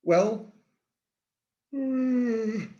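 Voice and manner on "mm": a long, high, drawn-out yawn. Real, not acted. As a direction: yawn mid-sentence. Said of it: "sorry about the yawn".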